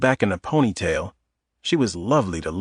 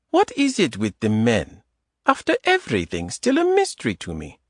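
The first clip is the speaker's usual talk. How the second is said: put-on light Eastern European accent. Adita teasing Tom in the grocery aisle, Eastern European accent. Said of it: "In a light Eastern European accent".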